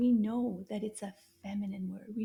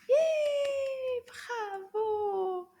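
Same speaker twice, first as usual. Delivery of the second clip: excited cheer; 11 semitones higher, 4 dB louder, much slower than usual. Real, not acted. Celebrating the pupil's answer.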